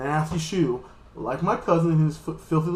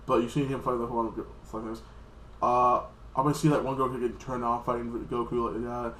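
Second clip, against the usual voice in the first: usual speaking voice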